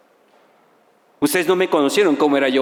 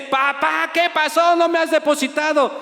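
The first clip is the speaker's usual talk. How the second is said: much higher, whiny son; complaining son; 12 semitones higher than usual. Retold or imitated. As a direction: whine it like a spoiled kid.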